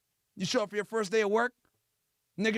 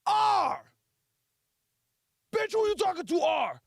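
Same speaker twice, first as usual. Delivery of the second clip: hammed-up offended shout; indignant; 7 semitones higher, slower than usual. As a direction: snap back as if insulted. Letter yelled, misheard, angry reply.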